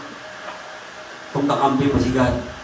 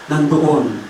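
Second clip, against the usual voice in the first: ordinary delivery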